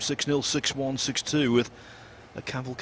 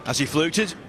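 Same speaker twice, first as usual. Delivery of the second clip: excited; 3 semitones higher, 5 dB louder than usual. Real, not acted. Commentator reacts to a fluke.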